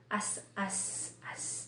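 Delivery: soft, hissed S running on in "as"; continuous final S. Shown as a demonstration. Teacher contrasting open endings with closed T, S sustained in "as". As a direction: let the S of "as" flow on, unstopped. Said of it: "Dare-diretso".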